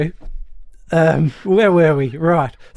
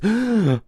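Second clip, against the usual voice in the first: high sharp gasp; shocked gasp; 8 semitones higher than usual. Retold or imitated. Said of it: "And Missus Marsden goes".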